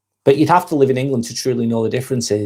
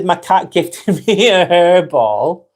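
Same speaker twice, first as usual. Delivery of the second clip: higher, slow, broken by a laugh; laughing while reading; 5 semitones higher, 4 dB louder, much slower than usual. Real, not acted. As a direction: giggle partway through reading it out. Amused reading out viewer's joke.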